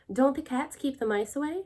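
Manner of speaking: gently confused; mildly puzzled. A narrator's reading. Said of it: "mildly puzzled".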